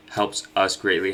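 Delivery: ordinary talk, nothing marked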